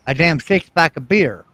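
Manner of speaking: normal talking voice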